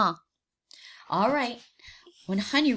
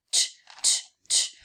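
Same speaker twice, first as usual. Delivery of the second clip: rattling tch. Acted. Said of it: "as he shook the bars".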